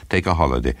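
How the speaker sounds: ordinary delivery, nothing marked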